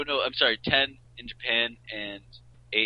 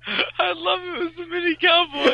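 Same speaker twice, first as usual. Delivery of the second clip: laughing; 9 semitones higher, 8 dB louder than usual. Real, not acted. Laughing at the nickname.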